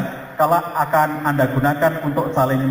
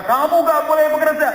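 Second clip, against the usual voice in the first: much higher, louder sudden forbidding; domineering husband; 11 semitones higher, 3 dB louder than usual. Retold or imitated. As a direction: blurt out the ban like a controlling husband. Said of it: "tiba-tiba Anda mengatakan". (suami melarang dengan suara tinggi dan keras)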